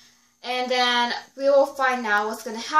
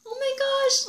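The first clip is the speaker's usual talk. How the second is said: a sudden high squeal of delight; excited gasp. Real, not acted. Fan gushing to viewers about the scene, excited squeal. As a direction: squeal it like a fan who can't believe it.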